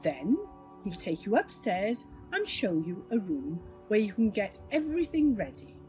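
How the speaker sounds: ordinary delivery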